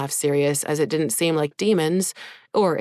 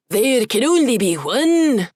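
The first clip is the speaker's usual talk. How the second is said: slow Highland battle cry, louder and higher; shouted Scottish brogue; 7 semitones higher, 7 dB louder than usual. Acted. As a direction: bellow it like a Highlander. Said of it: "cried out in an incomprehensible Scottish brogue".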